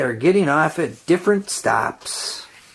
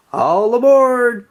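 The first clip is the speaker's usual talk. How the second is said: calling out; 7 semitones higher, 4 dB louder, slower than usual. A narrator's reading. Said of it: "calls the conductor".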